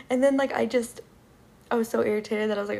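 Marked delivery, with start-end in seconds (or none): none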